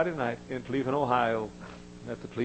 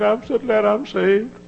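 crying woman; 11 semitones higher, 7 dB louder, slower than usual. Retold or imitated. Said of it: "she began to cry".

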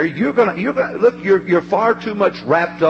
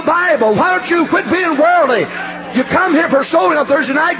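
shouted preaching; 12 semitones higher than usual. Acted. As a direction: hammer every question at the back pew.

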